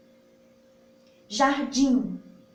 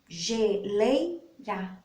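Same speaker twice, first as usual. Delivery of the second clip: syllables drawn out; much slower than usual. Shown as a demonstration. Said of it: "com duas sílabas".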